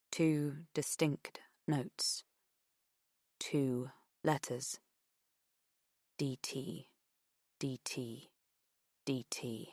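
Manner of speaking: slow hushed whisper. A narrator's reading. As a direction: breathe the letters quietly. Whispered inner thought.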